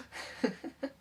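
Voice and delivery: brief chuckle; a quick breath of laughter; short laugh. Acted. Recalling the aunt's charming lisp, short chuckle.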